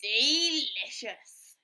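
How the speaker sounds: loud, very slow savouring; satisfied wolf. Acted. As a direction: smack the lips over it. Wolf after eating.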